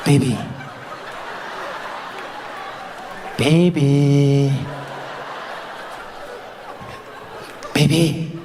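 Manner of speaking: low, coaxing bedtime voice; needy horny partner. Acted. Man behind dog-tired partner in bed, low coaxing. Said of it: "he's there behind you".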